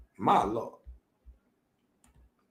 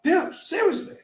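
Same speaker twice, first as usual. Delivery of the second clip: ordinary talk, nothing marked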